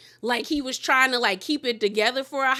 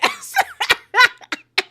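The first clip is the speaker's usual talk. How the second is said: a high-pitched laughing squeal; 6 dB louder than usual. Real, not acted. Host reacting to Toya egging Quad on, squealing laugh.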